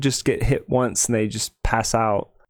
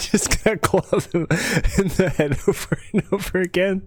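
higher-pitched, real giggly delivery; laughing while talking; 7 semitones higher than usual. Real, not acted. Player joking about clubbing him in the head, laughing. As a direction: let the laugh break into the words. Player cracks up at feeble attack.

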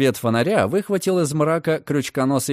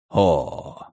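surprised chuckle; 9 semitones lower than usual. Acted. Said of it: "с коротким удивлённым смешком".

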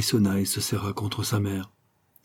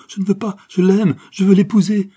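higher, louder, insistent plea; pleading protest; 11 semitones higher, 8 dB louder than usual. A narrator's reading. Daughter protests to her mother, higher and louder.

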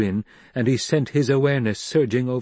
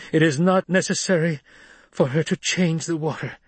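higher, frail but steady voice; weak but steady; 7 semitones higher than usual. A narrator's reading. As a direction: hold the words steady through weakness. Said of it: "His voice was weak, but steady".

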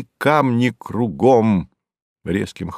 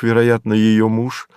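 ordinary delivery

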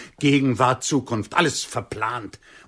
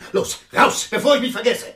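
raging, about to lose control; furious shout; 10 semitones higher, 6 dB louder than usual. Acted. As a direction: shout him out of the room, much higher and louder. Throwing the man out.

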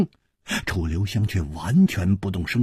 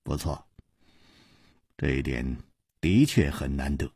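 much lower and softer, easy voice; reply with a quiet smile; smiling calm; 9 semitones lower, 7 dB quieter than usual. A narrator's reading. Chu Liuxiang smiling at Hu Tiehua, lower and softer. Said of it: "微笑着说".

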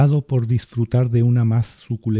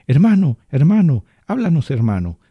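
loud, high, begging cries of brother; desperate pleading; 6 semitones higher, 5 dB louder than usual. A narrator's reading. Ushia wringing her hands begging her brother to speak, high and loud. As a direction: beg the spirit to answer.

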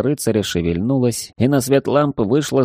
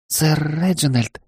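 shy maid voice; 7 semitones higher than usual. Acted. Embarrassed maid protests.

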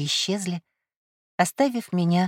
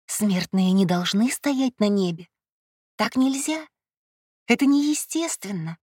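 alarmed protest; 2 semitones higher than usual. A narrator's reading. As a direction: protest in rising alarm, without shouting. Panicked thought, standing on sky. (встревоженный протест высоким голосом)

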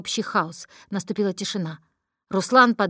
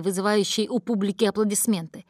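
ordinary delivery, nothing marked

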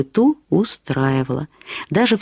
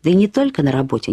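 usual speaking voice

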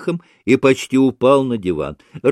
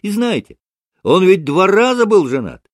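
high, loud, eager disclosure; excitable young man; 9 semitones higher, 6 dB louder than usual. Acted. Kalganov keeps telling Mitya about the two wives, excited. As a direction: blurt it out in a raised pitch.

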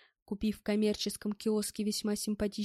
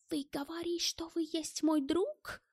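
high, slowing incredulous German voice; distrustful question; 7 semitones higher than usual. A narrator's reading. German doubts that Stas is his friend, distrustful question. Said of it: "недоверчиво спросил Гельмут".

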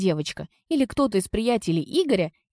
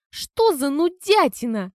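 disdainful; 7 semitones higher, slower than usual. Acted. Teen mocking classical music.

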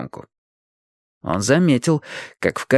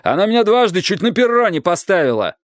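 indignant; 8 semitones higher, 3 dB louder than usual. A narrator's reading. Strike's indignant outburst goes on.

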